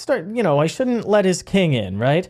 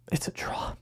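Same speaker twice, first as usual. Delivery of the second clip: whispered; 13 dB quieter than usual. Acted. Punchline after build-up.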